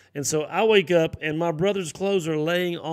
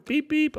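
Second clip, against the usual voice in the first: high-pitched beep-beep like a horn; imitated bus horn; 13 semitones higher than usual. Bus driver honking for a late kid, imitated horn. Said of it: "He blows the horn".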